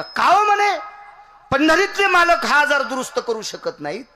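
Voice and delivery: high, loud, insistent questioning; full-force preaching. Acted. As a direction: demand an answer at the top of your voice. (पूर्ण जोशात, उंच आवाजात जाब विचारणे)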